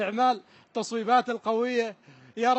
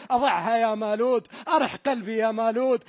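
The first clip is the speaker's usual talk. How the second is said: normal talking voice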